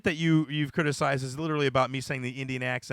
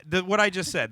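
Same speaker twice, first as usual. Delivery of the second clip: ordinary talk, nothing marked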